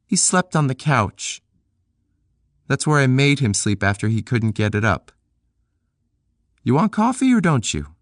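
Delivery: put-on high young woman's voice, friendly invite; Peg's voice. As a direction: voice Peg inviting him in. Narrator voices Peg.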